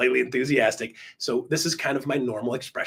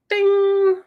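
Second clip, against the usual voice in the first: high, ringing ding; loud sudden ding of an idea; bell-like ding; 7 dB louder than usual. Acted. Recalling his own sudden realisation, ringing ding. Mimes idea striking him.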